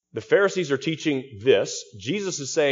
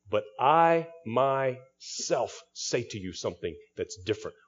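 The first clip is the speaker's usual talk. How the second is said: slow, weighty stress on "I myself", tailing off softer; emphatic I myself; 5 dB quieter than usual. Shown as a demonstration. Preacher voicing Jesus with an emphatic "I myself". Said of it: "Jesus is declaring".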